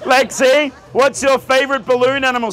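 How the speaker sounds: normal talking voice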